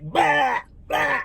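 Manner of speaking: high-pitched mocking blah noises; mock blathering. Mocking a date who overshares, high blathering. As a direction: imitate someone dumping all their troubles. Mimics the other person's venting.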